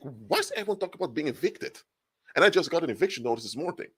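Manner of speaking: high-pitched, incredulous questioning; baffled thought. Acted. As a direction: voice a bewildered inner thought, pitched well up. Acts out a baffled inner thought.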